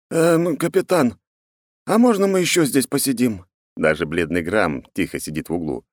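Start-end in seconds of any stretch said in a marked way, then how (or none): 0.1-3.5 higher-pitched, hesitant crewman's plea; timid request. Acted. Crew asking the captain to let them stay, timid.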